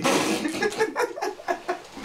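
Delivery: high squealing laugh; breathless shrieking giggle. Real, not acted. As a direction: burst out laughing. Cracks up at spit.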